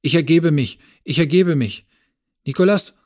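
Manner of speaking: frantic loud surrender shout. A narrator's reading. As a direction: yell it out, hands up, begging them not to shoot. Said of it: "der sofort die Hände hochnahm und rief".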